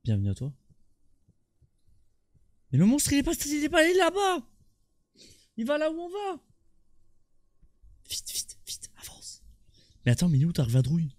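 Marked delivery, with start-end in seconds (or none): 2.7-6.4 panicked high voice. Real, not acted. Panicking about monster. 8.1-9.4 urgent whisper. Real, not acted. Urging while hiding.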